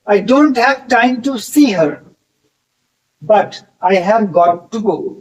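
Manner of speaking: very slow, careful English dictation; slow example sentence. Shown as a demonstration. Teacher models the have-got sentence for students, slow and careful. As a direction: lay out each word for the class.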